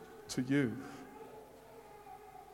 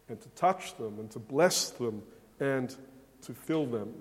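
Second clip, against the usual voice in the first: usual speaking voice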